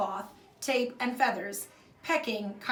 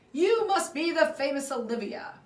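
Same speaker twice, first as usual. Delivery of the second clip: big, loud friendly welcome; booming greeting; 6 semitones higher, 7 dB louder than usual. Acted. The neighbor greets Livy on arrival with a booming voice. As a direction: boom it out, all friendly. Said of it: "The man booms".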